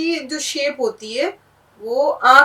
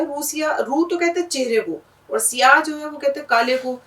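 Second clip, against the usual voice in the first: ordinary talk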